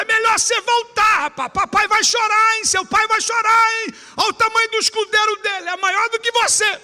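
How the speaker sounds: high, loud taunting yell; mock shout. Saul yelling at Davi to go home, high mocking shout. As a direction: shout it down at the boy, teasing. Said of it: "Aí o Saul grita de lá".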